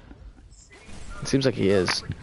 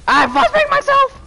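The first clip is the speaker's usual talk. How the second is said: much higher, louder, slow squeal of shock; startled yelp; 6 dB louder than usual. Real, not acted. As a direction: shriek it out, caught by your own flashbang. Shocked at own flashbang.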